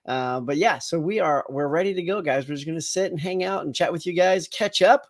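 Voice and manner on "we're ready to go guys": ordinary talk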